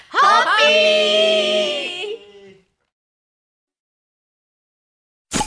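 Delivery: drawn-out toast cheer. Real, not acted. Toast call at end of show.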